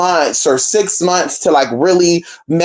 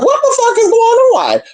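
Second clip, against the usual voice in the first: shocked outcry. Retold or imitated. Asking Spirit why he ignores her, a high alarmed outcry. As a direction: cry out in alarmed disbelief.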